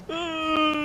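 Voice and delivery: high, hammed-up dying scream; mock scream. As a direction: cry out as if ending it all. Ends it all, scream.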